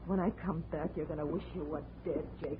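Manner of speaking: a quiet promise of revenge; low threat. Acted. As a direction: hiss the threat at him. Whispered threat to Jake.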